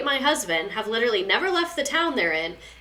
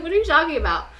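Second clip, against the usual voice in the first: mock baffled; 7 semitones higher, faster than usual. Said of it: "And I'm like".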